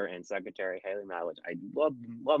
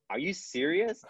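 higher, retold astonished question; disbelieving; 5 semitones higher than usual. Hearing he got reelected, incredulous. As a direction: act out stunned disbelief.

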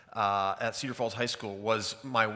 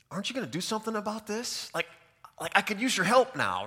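higher, urgent plea to the teacher; pleading appeal; 8 semitones higher than usual. Retold or imitated. Retelling, begging his PE teacher for help, higher urgent plea. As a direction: beg him for help, pitched up and fast.

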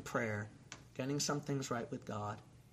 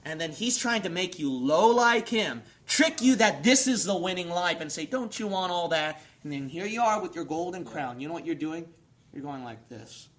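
loud, fiery sermon, louder and higher; preaching at full force; 4 semitones higher, 10 dB louder than usual. Acted. Preacher warning the congregation about Satan, shouted. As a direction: pound it out from the pulpit.

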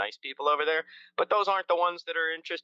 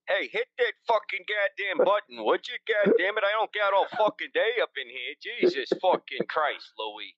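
New York cabbie; 5 semitones higher than usual. Retold or imitated. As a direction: yell like a fed-up NY cab driver. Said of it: "the ultimate New York asshole, the cabbie".

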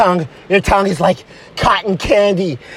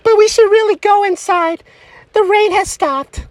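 high, sweet-talking sweetheart; cartoonish high girlfriend voice; coaxing girlfriend; 9 semitones higher than usual. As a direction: coax your boyfriend in a high voice. Girlfriend coaxes him inside.

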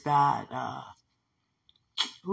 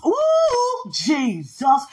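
ecstatic cry; 11 semitones higher, 6 dB louder, much slower than usual. Real, not acted. Preacher overcome mid-sermon.

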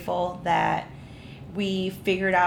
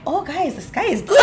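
higher, bright-eyed "oh guys" with a laugh; chirpy agreement; 10 semitones higher than usual. Retold or imitated. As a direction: suddenly agree as if it's obvious. Myra agreeing only with Denise.

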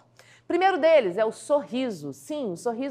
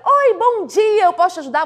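pushy saleswoman; 9 semitones higher, 10 dB louder than usual. Acted. Saleswoman greeting a shy customer, high and loud. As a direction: belt out a greeting that's far too much.

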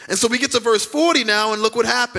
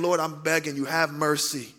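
begging prayer; 8 semitones lower, 6 dB quieter than usual. Retold or imitated. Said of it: "That's all I could keep saying".

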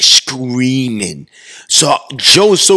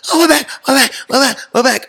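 high, pained "my back" repeated; hammed-up wail, much higher; mock pained whimper; 11 semitones higher, faster than usual. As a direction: imitate a friend writhing in pain. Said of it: "He's like".

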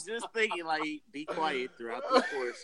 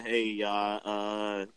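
mock eulogy; 8 semitones lower, 3 dB quieter than usual. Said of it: "We said".

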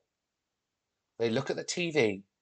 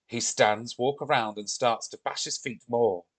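ordinary delivery

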